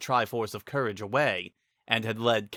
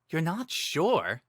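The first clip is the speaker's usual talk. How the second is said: slow, higher disbelieving echo; disbelief; 6 semitones higher, much slower than usual. Acted. Link disbelieving Sing's answer, slow and higher. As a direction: repeat it, not believing her. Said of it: "asked in disbelief".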